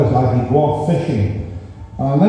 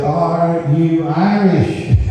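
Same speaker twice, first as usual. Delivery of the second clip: slow question; 3 semitones higher, much slower than usual. Acted. Uncle asks slowly.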